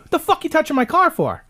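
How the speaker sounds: high indignant challenge; retold angry yell. Yelling at the tire-marking guy, high and angry. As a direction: snap at him like he touched your car.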